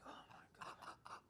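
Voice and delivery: whispered Oh my God under the breath; a stunned, breathless whisper; shocked whisper. Retold or imitated. Shocked reaction to staff.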